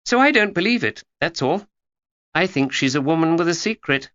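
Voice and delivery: put-on a know-it-all girl confiding; young Georgia. A narrator's reading.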